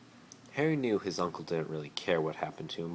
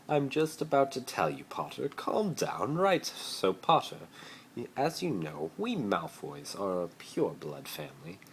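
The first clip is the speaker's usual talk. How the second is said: much higher, condescending; haughty Malfoy; 5 semitones higher than usual. Acted. Malfoy lecturing Harry about his family, haughty. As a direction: talk down to him. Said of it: "Malfoy explaining to Harry".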